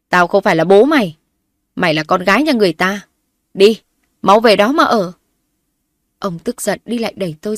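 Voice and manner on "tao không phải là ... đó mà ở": loud, angry disowning rant; enraged father shouting at his daughter; furious father. Acted. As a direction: throw her out with rage.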